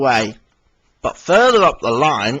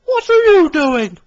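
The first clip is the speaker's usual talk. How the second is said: Daisy voice; 7 dB louder than usual. Acted. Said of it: "she purred".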